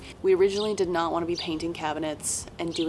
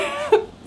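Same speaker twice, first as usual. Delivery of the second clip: bright burst of laughter; high laugh; 5 dB louder than usual. Real, not acted. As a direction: laugh high at your own joke. Laughs at own bit.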